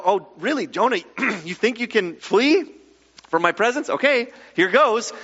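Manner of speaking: a touch of sarcastic disbelief; mock challenge. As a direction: toss out a mock dare to a runaway. Imagines God challenging fleeing Jonah.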